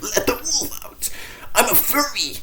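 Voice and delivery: goofy high-pitched furry voice; squeaky silly voice. Acted. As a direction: jump way up into a tiny squeak. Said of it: "that sounded weird".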